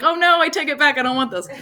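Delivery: high, alarmed take-back; mock panic. Speaker imagines her child self regretting it, mock panic. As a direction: pretend to panic and retract the wish.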